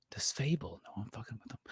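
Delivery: teasing whisper about Fable; mock whisper. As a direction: lean in and whisper it conspiratorially.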